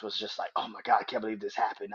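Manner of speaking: normal talking voice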